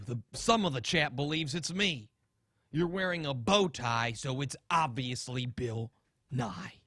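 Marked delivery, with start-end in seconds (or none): none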